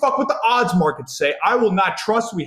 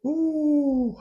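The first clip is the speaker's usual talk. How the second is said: high, drawn-out wincing "ooh"; long pained "ooh"; 10 semitones higher than usual. Real, not acted. Fan imagining losing to Cal, long high "ooh". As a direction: hold a high "ooh" in dread.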